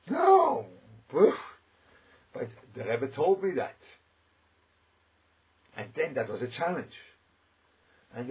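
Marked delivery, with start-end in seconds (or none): 0.1-3.8 emphatic denial. Real, not acted. Insisting against objection.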